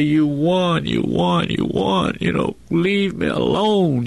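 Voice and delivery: giving up, begging them to leave him be; desperate surrender. Retold or imitated. Prisoner in solitary surrendering to the guards, rising pleading voice. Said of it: "I was gonna say".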